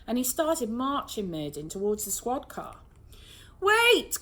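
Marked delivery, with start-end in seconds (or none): 3.6-4.2 high, loud urgent cry; sudden cry. A narrator's reading. Mum crying out to stop the arrest, loud. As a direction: stop everyone at once. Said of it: "cried Rose's mum suddenly".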